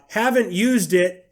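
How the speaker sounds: high, slow Southern drawl; Southern accent. Acted. Mocking a pointless Amazon review in a high, slow Southern accent. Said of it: "I'm using a Southern accent".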